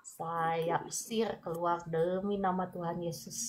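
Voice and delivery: soft, tender prayer against fear; calm the worried one softly as you pray; gentle prayer. Acted. Prayer for an anxious viewer. (doa lembut yang menenangkan)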